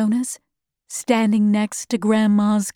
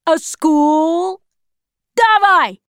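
high scornful question building to a shout; jeer, then yell the order; officer's contemptuous, rising bark; angry taunt; 5 semitones higher, 5 dB louder, slower than usual. A narrator's reading.

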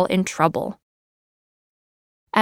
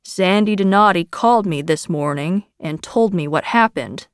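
plain reading voice

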